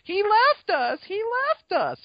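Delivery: high, loud imitation of a panicking child; mock screaming. Telling how Lex starts screaming, mock scream. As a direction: screech it in a mock falsetto wail. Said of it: "she starts screaming, like".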